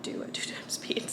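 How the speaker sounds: breathy half-laughed whisper; laughing whisper. Real, not acted. Vlogger admits she can't do the accents, whispering through a laugh. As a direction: let a giggle slip through a whisper.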